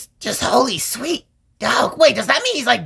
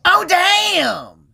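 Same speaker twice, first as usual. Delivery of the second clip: surprised exclamation; 7 semitones higher than usual. Real, not acted. Shocked reaction to eternal damnation.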